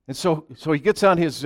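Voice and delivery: ordinary talk, nothing marked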